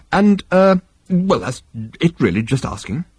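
halting, flustered backtracking; nervous. A narrator's reading. Arthur under everyone's stare, flustered nervous excuse. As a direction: stumble and tail off awkwardly. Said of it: "said it so nervously".

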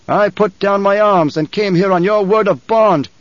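shouted. A narrator's reading. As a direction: shout it in outrage. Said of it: "the Emperor shouted".

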